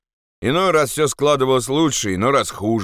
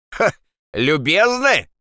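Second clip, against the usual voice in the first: scoffing call, pitched up; shouting; 8 semitones higher, slower than usual. Acted. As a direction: throw it back as a mocking call. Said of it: "крикнул кто-то". (насмешливый окрик)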